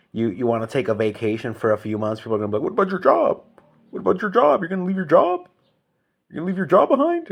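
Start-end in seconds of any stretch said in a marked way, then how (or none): 2.6-7.3 high, loud incredulous whine; high mock disbelief, faster at the end; mocking whiny voice. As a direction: whine it like a shocked busybody. Mocking what people say.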